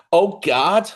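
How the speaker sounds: normal talking voice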